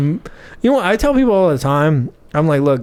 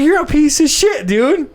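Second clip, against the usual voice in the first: high-pitched, outraged protest; retold indignation; 12 semitones higher than usual. Wrongly tased man tells off the officer, indignant.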